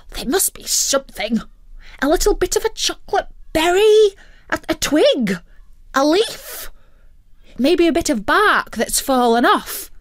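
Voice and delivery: whispered start, then a high, fast pleading squeak; a hungry bird scrabbling for scraps; whining turkey voice; desperate turkey. Acted.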